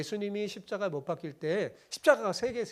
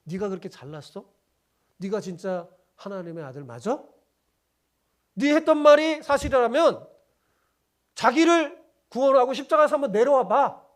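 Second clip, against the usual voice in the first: mocking taunt; 4 semitones higher, 8 dB louder than usual. Preacher voicing the crowd taunting Jesus, sneering up to a loud jeer. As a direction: needle him, then shout the dare. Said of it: "말하자면 이렇게 조롱한 거예요".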